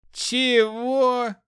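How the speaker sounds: high, drawn-out outraged question; indignant disbelief. A narrator's reading. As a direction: squawk it in affronted disbelief. Guard reacts to insult.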